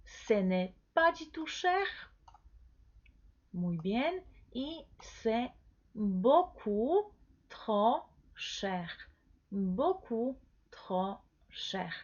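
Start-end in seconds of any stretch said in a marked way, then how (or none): 0.0-1.9 "Ce n'est pas du tout cher" in careful chunks, pitched up; higher-pitched teacher's model of "pas du tout cher"; pronunciation model. Shown as a demonstration. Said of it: "Vamos a repetir entonces la pronunciación". 5.0-11.9 "beaucoup trop cher" word by word, very slow; drawn-out, separated "beaucoup", "trop", "cher"; slow pronunciation model. Shown as a demonstration. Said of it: "Vamos a repetir entonces la pronunciación".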